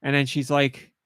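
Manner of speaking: usual speaking voice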